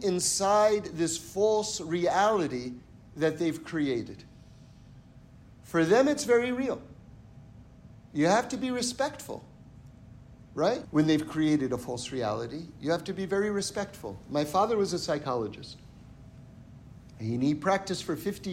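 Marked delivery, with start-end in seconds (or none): none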